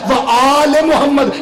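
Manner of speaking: slow, with आल drawn out long; लमकाया कर: stretch "आल" in व आल Muhammad. Shown as a demonstration. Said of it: "ये लंबा करना चाहिए".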